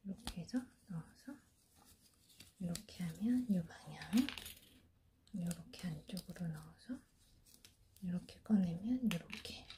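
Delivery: soft unvoiced whisper while demonstrating; hushed how-to whisper; whispered instructions. Real, not acted. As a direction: whisper each step as you work with your hands.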